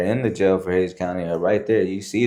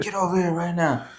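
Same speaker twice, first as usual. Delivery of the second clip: screamed-out rasp; 9 semitones higher than usual. Retold or imitated. Raspy instructor ordering the recruit over, mock yell. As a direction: imitate an instructor hoarse from screaming. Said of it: "from screaming the whole time".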